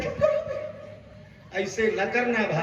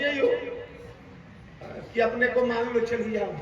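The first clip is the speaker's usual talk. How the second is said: ordinary talk, nothing marked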